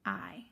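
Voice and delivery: I said as a long drawn vowel, dropping low; teacher showing the sound of IGH. Shown as a demonstration. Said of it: "I G H say".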